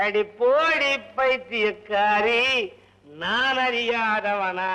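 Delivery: very slow, stretched "அடி போடி பைத்தியக்காரி" with a rise at the end; drawn-out singsong. Acted. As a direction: drag every syllable of "அடி போடி பைத்தியக்காரி" out like a drunk crooning. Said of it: "repeated slowly".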